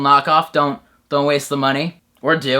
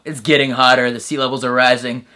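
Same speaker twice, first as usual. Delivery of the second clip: ordinary delivery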